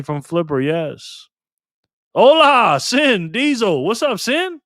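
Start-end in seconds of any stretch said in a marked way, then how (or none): 2.1-4.6 high, loud, stretched hello; excited greeting. Real, not acted. Streamer loudly greets a regular viewer, high and stretched. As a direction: call out his name like a hype man.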